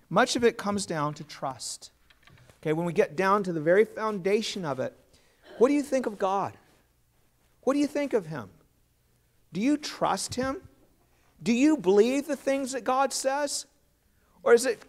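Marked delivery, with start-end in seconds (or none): none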